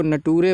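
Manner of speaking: नटूरे with a hard t and -oore ending; misreading of nature. Shown as a demonstration. Teacher quoting pupils' mistake, misread नटूरे with hard t. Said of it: "नेचर को नटूरे पढ़ देते हैं".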